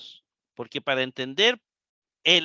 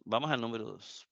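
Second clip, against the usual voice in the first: softer, fast muttered repeat of "vamos al número dos"; quick quiet repeat; 6 semitones lower, 11 dB quieter, faster than usual. Shown as a demonstration. Teacher repeats the announcement to the class, softer and quick. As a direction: toss off "vamos al número dos" softly and quickly, half to yourself.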